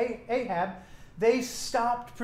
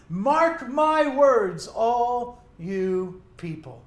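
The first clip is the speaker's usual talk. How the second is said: declaration; 3 semitones higher, 6 dB louder, much slower than usual. Acted. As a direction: proclaim it slowly to the whole crowd. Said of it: "like a declaration".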